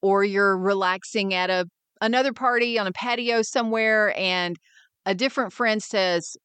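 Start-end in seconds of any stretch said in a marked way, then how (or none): none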